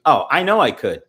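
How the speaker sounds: normal talking voice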